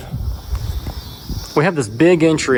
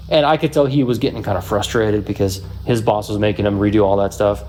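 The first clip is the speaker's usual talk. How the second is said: ordinary delivery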